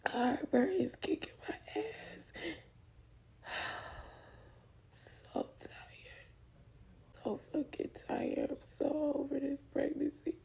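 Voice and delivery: high, soft tearful whisper; worn-out crying whisper. Real, not acted. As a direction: weep it out, exhausted. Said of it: "I was crying".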